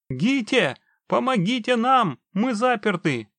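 high, loud panicked plea; panicked cry. A narrator's reading. Trapped man calls through the videophone, panicked. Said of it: "ворвался панический голос живого".

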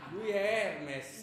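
grand announcement. Acted. Pupil presenting Hermes to the teacher, slow and loud. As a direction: unveil him like a showman.

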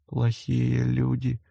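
slow, soft ghostly whisper. A narrator's reading. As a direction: hush it like a warning. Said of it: "шепнул Ри". (шёпот призрака)

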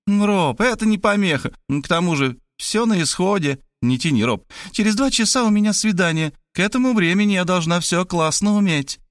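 put-on high girlish voice, pressing him; impatient young woman. A narrator's reading. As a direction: hurry him along in a high voice. (высокий девичий голос, нетерпеливо)

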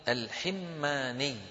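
الحماني said slowly "al-Himmani", i after the h; kasra on ha. Shown as a demonstration. Teacher reading the narrator's name, slowly pronounced "al-Himmani". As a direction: draw out الحماني with doubled m. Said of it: "بكسر الحاء".

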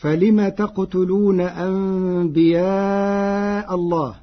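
slow, chanted tajwid recitation; Quran recitation. Acted. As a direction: recite it melodically and slowly.

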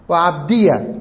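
عبدية ending in a clear "ee-ya"; open final ya. Shown as a demonstration. Teacher listing variant forms, voweled ya on عبدية. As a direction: finish عبدية with ya plus short a. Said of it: "بإثبات الياء مفتوحة".

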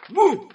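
high, loud isolated bursts, long gaps between; stuck, high-pitched loud outbursts; hiccup-like syllable. A narrator's reading. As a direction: repeat the false start like hiccups. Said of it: "hıçkırık tutmuş gibi".

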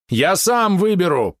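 high, loud and slow; shouting. Acted. Polot insists on choosing his opponent, shouting high and loud. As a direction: yell your right at them. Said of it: "прокричал Полот".

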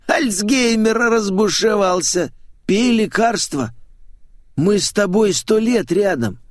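high, drawn-out, merry teasing; cheerful showman. A narrator's reading. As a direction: tease gleefully in a high theatrical voice. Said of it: "весело констатировал".

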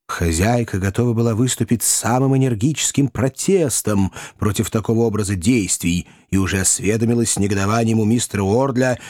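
usual speaking voice

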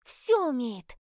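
blurted out proudly, high and loud. A narrator's reading. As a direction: burst out with pride. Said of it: "гордо выпалила Малика".

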